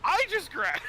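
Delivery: high, loud disbelieving cry; shocked exclamation. Real, not acted. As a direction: shout it in shock. Game suddenly crashes.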